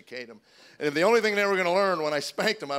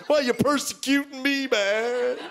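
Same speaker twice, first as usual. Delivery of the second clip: put-on high, wounded teenage complaint; dramatic teen; 6 semitones higher, slower than usual. Son protests to dad, hammed-up dramatic teen. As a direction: play the hurt, put-upon teen. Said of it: "They really get dramatic".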